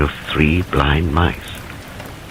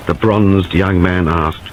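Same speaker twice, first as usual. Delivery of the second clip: ordinary delivery, nothing marked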